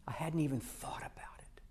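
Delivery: "I hadn't even thought about it" fully whispered, no voice; hushed aside in wonder. Shown as a demonstration. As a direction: breathe the realization to yourself. Realization said under breath.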